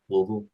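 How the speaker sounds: Louisville squashed to LUL-vul; swallowed Louisville. Shown as a demonstration. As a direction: try out Louisville with the middle swallowed. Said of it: "trying to s-, swallow it down".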